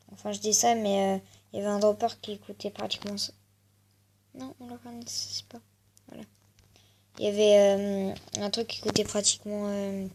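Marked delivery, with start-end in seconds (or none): 4.4-6.2 playful whisper. Real, not acted. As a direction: whisper it as a joke. Whispered joke aside.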